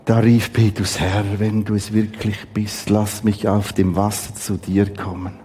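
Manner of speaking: ruhig und tiefer vorgelesen; Bibellesung. Acted. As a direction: lies den Text gesammelt und gemessen vor. Liest Matthäus vor, leiser. (feierliche, leise Schriftlesung)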